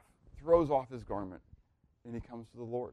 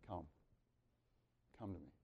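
gentle call; 8 semitones lower, 22 dB quieter, faster than usual. Acted. Jesus calls gently to Bartimaeus.

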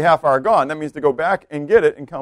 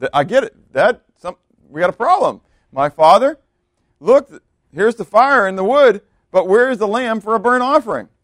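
questioning son; 7 semitones higher, 5 dB louder than usual. Acted. Voices Isaac questioning his father.

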